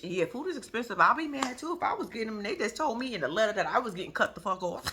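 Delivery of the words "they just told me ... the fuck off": usual speaking voice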